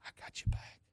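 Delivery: hushed, confidential whisper; psst, then softly promise you have it covered; secretive, very soft comfort; God's reassuring whisper. Acted. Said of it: "And God says".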